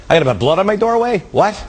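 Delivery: high, rushed, incredulous question; mock disbelief. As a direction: blurt it out in comic exasperation. Voicing an exasperated plague sufferer.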